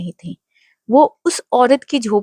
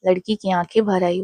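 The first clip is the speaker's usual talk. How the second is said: ordinary delivery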